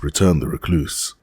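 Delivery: ordinary delivery, nothing marked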